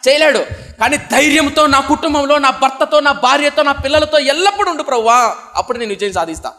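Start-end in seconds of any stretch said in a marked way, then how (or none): 0.8-5.4 higher, louder pleading to the Lord; fervent prayer. Acted. Preacher praying for his family to the Lord, higher and louder plea. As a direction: beg the Lord to stay, with full force.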